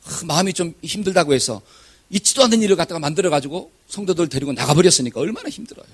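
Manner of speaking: ordinary delivery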